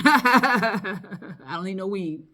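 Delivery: slow, laughing protest; laughing denial. Real, not acted. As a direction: laugh it off slowly.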